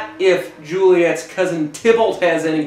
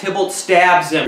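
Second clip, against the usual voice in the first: usual speaking voice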